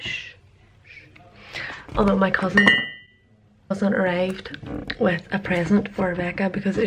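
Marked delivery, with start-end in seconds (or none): 0.0-1.6 hushing. Real, not acted. Hushing a secret.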